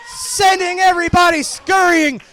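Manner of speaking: wrestling announcer yelling over the chaos; excited play-by-play. Acted. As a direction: call the brawl like it's live and chaotic. Live play-by-play of ringside brawl.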